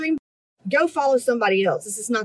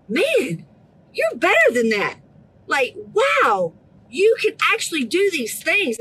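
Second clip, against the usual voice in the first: emphatic, raised-pitch pep talk; fired-up, insistent encouragement; passionate urging; 5 semitones higher than usual. Real, not acted. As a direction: push them to believe they can. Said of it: "I'm so passionate".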